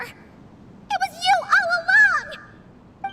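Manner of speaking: slow, loud accusing cry; loud accusation. Acted. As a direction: hurl the accusation. Realizing the betrayal.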